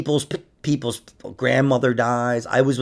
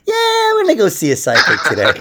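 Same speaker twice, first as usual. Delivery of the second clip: mock cheery housewife; 3 semitones higher, 5 dB louder than usual. Mocking a married woman's thinking.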